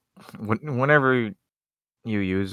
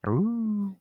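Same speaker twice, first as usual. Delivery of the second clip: wolf howl; 13 semitones higher than usual. Pretend. Howling Arcanine joke.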